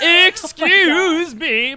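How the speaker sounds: high, loud, very drawn-out; indignant interruption. Acted. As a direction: stretch it out in outrage.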